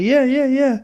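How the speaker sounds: high, rushed mock yeses; eager agreeing. The boys eagerly answering the cop, high and quick. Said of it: "We're like".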